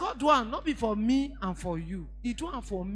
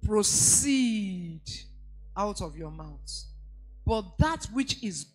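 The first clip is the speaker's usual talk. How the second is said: slow, weighty reading with punched words; scripture reading; 3 dB louder, slower than usual. Acted. Preacher to congregation, slow solemn scripture reading. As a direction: read the verse like a pronouncement.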